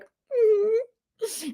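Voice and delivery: small high-pitched whimpering hum; mock whimper. Wife admitting she's feeling sensitive, playful high whimper. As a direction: whimper a tiny, pouty yes. Acting out her sensitive reply.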